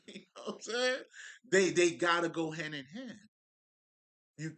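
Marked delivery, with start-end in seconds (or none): 0.0-1.0 high-pitched laughing check-in. Real, not acted. Speaker asks listeners whether they follow, laughing. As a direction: laugh through the question.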